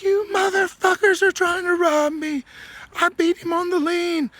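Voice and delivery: a sore loser screaming he's being robbed; furious accusation. Retold or imitated. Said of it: "screaming and yelling, all pissed off".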